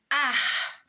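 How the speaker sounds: "ar" alone, drawn out and firm; isolated syllable. Shown as a demonstration. Teacher sounding out the first syllable to a class, isolated syllable. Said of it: "A primeira sílaba".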